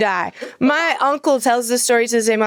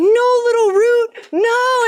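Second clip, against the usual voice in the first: high, drawn-out mock despair; kid imitating movie wail; 13 semitones higher, slower than usual. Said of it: "holding onto a slide saying".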